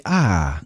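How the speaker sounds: long AH falling low, no R; Boston ah sound. Shown as a demonstration. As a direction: drop the R into an open AH. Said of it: "take any A R word and just say".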